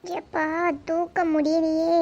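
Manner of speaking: soft, slow groaning complaint; weary struggle. Acted. Mother alone lugging heavy bags, worn-out and quiet. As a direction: drag it out under a heavy load.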